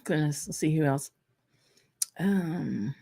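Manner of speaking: mid-sip, words around a drink; while sipping a drink. Real, not acted. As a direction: talk between sips of whiskey. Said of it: "Pardon me as I drink this".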